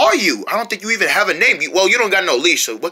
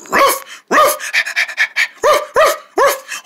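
very high little puppy yaps; puppy bark; much slower than usual. Acted. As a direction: yip happily like a small puppy. Puppy voiced barking.

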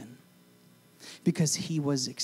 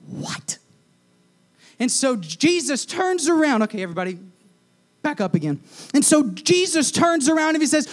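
full-force preaching; 9 semitones higher, 7 dB louder than usual. Real, not acted. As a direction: drive the point home to the room.

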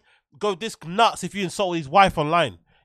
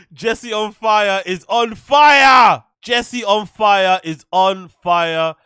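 slow, loud drawn-out hype chant; hype shout; 2 semitones higher, 10 dB louder, much slower than usual. Real, not acted. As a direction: shout it out like an announcer. Hyping up the streamer.

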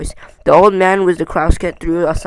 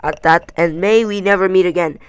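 louder, grudging dismissal; grim; 7 dB louder than usual. A narrator's reading. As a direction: send them off grimly and firmly. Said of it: "Thorin grimly".

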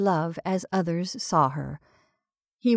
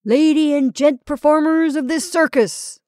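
high, loud ringmaster's proclamation; jovial announcement; 7 semitones higher, 8 dB louder than usual. A narrator's reading. Ringmaster addresses the circus performers, jovial announcement. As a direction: boom it to the crowd like a ringmaster. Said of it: "announced the ringmaster jovially".

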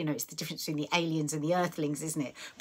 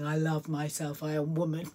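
mocking affirmation. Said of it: "you people say, saying".

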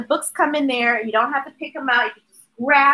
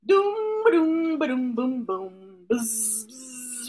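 fading drum sounds; 8 dB quieter, slower than usual. Acted.

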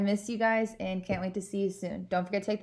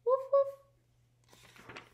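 high little puppy woof; dog sounds; 3 dB louder than usual. Acted. Teacher reading to her class, a high puppy bark. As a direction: bark it like a small playful dog. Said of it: "all of my dog sounds".

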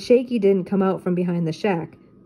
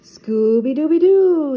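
high, loud, slow drawn-out dog howl; Scooby yell; 7 semitones higher, 7 dB louder, slower than usual. Acted. Scooby yells as he snowboards into the snowdrift, loud and high. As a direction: howl it while crashing into the snow. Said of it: "Scooby yelled".

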